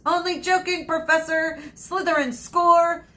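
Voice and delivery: loud, high play-by-play; sports commentary. Acted. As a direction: call the match like a commentator. Held commentator voice again.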